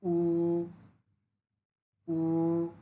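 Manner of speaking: উ rising up; Mandarin second tone. Shown as a demonstration. Teacher shows the second tone on উ, rising. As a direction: raise উ toward the end. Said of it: "second tone উপরের দিকে উঠে যাবে".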